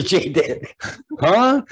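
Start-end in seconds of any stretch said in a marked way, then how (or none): 1.2-1.6 high, loud disbelieving huh; stunned huh. Acted. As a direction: squeak out shocked disbelief. Suitor stunned by rejection.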